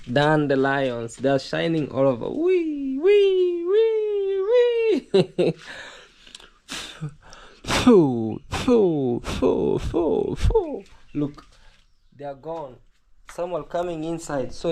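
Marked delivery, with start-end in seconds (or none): 2.3-5.5 high, loud "wee" squeals; gleeful squeal. Real, not acted. Watching dandelions shining all over, gleeful high squeal. 7.6-10.8 short, forceful blown "phew" puffs; blowing puffs. Real, not acted. Blowing the dandelions away, forceful puffs.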